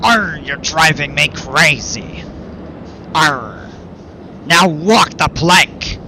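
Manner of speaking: slow, growly mock pirate. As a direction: ham it up like a pirate. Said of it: "if we drive you crazy in pirate terms".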